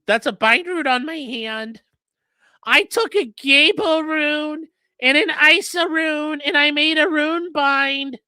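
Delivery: high, slow mock voice; mocking Holder. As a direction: mimic him high and slow, nerdy. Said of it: "And he says".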